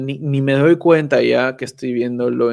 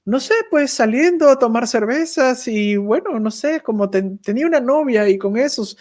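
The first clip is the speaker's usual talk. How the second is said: voiced much higher, a casual shrug in the voice; carefree young learner; 9 semitones higher than usual. An easygoing young learner explaining how he learned German, voiced much higher and casual. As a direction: lightly play the easygoing learner who just picked it up. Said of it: "Les preguntas, "¿Cómo lo aprendiste?".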